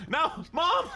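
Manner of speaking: high playful yell; mock shout. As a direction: yell it like a kid at mom.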